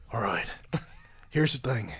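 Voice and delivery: attempted Irish lilt, starting low; amateur Irish accent. Shown as a demonstration. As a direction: ease into a rough Irish accent. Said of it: "I can do an Irish accent".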